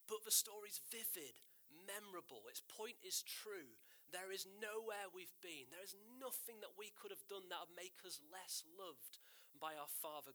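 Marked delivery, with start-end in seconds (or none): none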